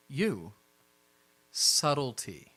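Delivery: very slow, spaced-out "Subtlety"; deliberate, measured rebuke; pointed admonition. Acted. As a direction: draw the word out as a warning. [name] pointedly tells one character: subtlety.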